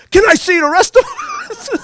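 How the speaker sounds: put-on high, slow little-kid voice; eager child. Five-year-old asking to see the rest of the witch. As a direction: ask it wide-eyed like a small boy.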